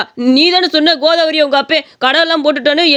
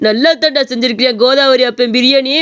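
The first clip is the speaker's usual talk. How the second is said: ordinary delivery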